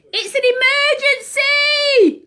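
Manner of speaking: high, loud urgent shout. Acted. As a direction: cry out the alarm, pitched high. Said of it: "cried Ozzy".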